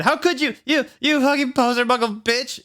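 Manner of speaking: very high, shrill insult; shouting. Acted. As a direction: yell the insult. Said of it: "he shouted".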